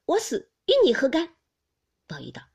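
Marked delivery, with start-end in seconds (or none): 0.0-1.4 high, loud, indignant challenge; sharp quarrelling outburst, raised pitch; indignant retort. A narrator's reading. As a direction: throw the question back hotly. Daiyu quarrelling with Baoyu.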